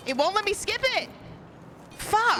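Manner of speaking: exasperated. Real, not acted. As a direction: pitch climbing in real exasperation. Stuck cutscene, escalating frustration.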